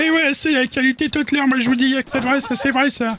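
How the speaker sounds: very high falsetto character voice; Tutler. Acted. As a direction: squeak it out far above your own pitch. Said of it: "Il fait la voix de Tutler". (voix de personnage suraiguë)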